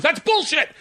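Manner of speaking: high-pitched mock outburst; retold protest. Josh objecting to the landslide result, mock outraged. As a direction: cry foul like a sore loser.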